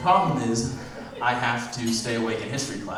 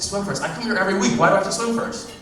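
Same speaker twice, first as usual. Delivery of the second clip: indignant protest; 9 semitones higher, 4 dB louder than usual. Acted.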